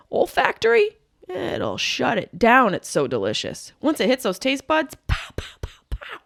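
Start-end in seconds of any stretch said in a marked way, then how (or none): 5.1-6.2 punch sounds. Pretend. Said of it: "Is Mike Tyson in my mouth?".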